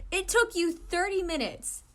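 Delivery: slow, loud, high exasperation; exasperated. Acted. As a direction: drag out the annoyance. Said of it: "Iwizumi looked ticked off".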